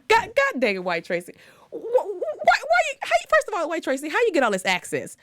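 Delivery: high, stammering disbelief; exasperated. Acted. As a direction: throw up your hands at her. Exasperated at the character.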